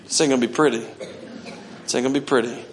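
soft chuckling warning; laughing aside. Real, not acted. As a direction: chuckle it under breath. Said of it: "laughing aside".

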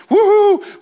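high, loud celebratory whoop; whoop of joy. Acted. Preacher mimicking a believer's joy, loud high whoop. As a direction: cheer like you just won.

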